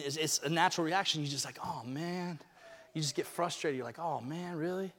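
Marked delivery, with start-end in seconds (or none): 1.6-4.9 soft, lower "oh man, really"; deflated. Retold or imitated. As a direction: mutter your letdown under your breath. Voicing frustration, much softer.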